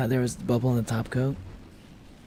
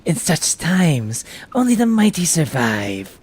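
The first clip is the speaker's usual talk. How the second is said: slow, hushed loyal servant; slow reverent minion voice; devoted servant voice; 6 semitones higher, 7 dB louder, much slower than usual. Acted. Voicing a game character's dialogue.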